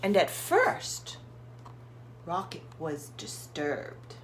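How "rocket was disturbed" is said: ordinary delivery, nothing marked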